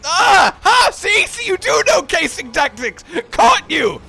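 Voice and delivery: triumphant shout. Real, not acted. Catches friend out, triumphant.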